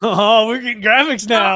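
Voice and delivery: loud, delighted surprise; surprised shout. Real, not acted. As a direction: react to the new graphics out loud. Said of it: "Oh, is that new?".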